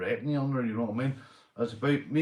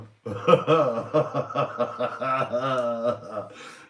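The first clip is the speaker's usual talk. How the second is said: loud laugh; 2 semitones higher, 5 dB louder than usual. Real, not acted. Cracking up at a chat comment.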